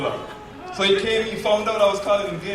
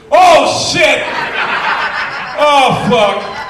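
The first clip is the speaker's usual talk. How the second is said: high, loud shocked oh shit; dawning horror with a laugh; horrified realisation; 9 semitones higher, 8 dB louder, much slower than usual. Retold or imitated. Teller realising the prank after googling, loud shocked cry. Finding out what gist means.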